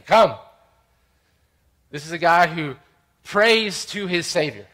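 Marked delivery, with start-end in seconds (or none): 0.0-0.4 loud, higher one-word order; forceful, punched command. Acted. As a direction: summon him with authority. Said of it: "He commands him".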